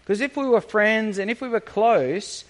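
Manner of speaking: ordinary talk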